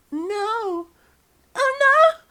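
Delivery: high, loud falsetto lover, slow and swooning; breathy partner. Acted. Narrator voicing the lover in a high hammed-up falsetto.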